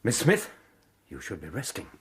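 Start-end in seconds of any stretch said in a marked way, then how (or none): 1.1-1.9 much softer, gently urging her; hushed, anxious care; soft concern. Acted. Villain urging the shocked heiress to rest, soft concern.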